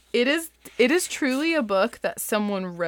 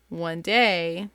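ordinary talk

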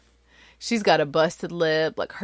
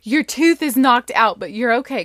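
loud, higher urgent mother replayed; urgent reassurance; 6 semitones higher, 9 dB louder than usual. Mom to injured daughter, urgent retold reassurance. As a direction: take charge of a hurt child, retold. Mother retells addressing hurt child.

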